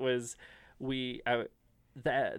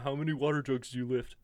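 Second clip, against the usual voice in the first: bro voice; faster than usual. Retold or imitated. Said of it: "in that bro voice".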